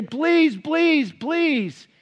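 high, loud pleading please; a hint of tearful pleading; mock begging. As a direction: beg her, voice held up high. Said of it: "beg her and say".

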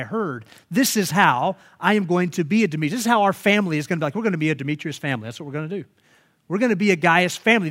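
firm, loud resolve; committed family vowing change; resolute declaration. Retold or imitated. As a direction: declare the plan with conviction. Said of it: "rarely are we sitting there thinking".

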